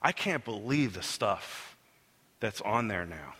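1 inner thought. Retold or imitated. And I thought to myself.

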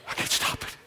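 hushed pretend panic; mock whisper. Worshipper whispering to spouse during service, mock panic. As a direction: whisper as if it's taking over. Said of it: "pretend worshipper losing control".

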